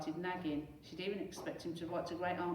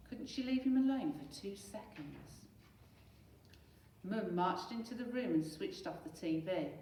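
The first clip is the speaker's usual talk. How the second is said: ordinary delivery, nothing marked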